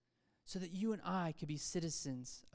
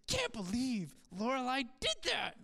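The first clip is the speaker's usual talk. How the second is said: higher, exaggerated indignant disbelief; mock disbelief; 5 semitones higher, 3 dB louder than usual. Miserable viewer reacting to Lorelai's choice, mock disbelief. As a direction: play up the scandalized fan. Retold upset over TV show.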